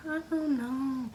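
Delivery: high, slow whimper. Retold or imitated. Her high tearful whine back to the friend. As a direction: whine it out helplessly. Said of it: "I was like".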